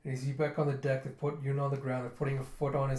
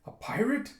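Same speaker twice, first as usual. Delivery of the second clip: incredulous question leaping higher at the end; surprised guard; 8 semitones higher than usual. Retold or imitated. Guard reacting to Yun's confession, surprised. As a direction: act out a guard's rising disbelief. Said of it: "The guard is surprised".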